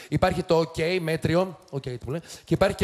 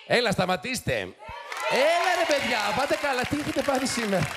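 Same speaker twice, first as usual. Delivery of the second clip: scolding the audience; 7 semitones higher than usual. Real, not acted. Host scolds the noisy audience.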